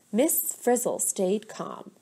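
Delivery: usual speaking voice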